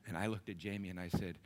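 ordinary delivery

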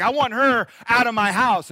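Sarah voiced, demanding Abraham evict Hagar; mock angry demand. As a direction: throw the servant out, sharply. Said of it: "She's like".

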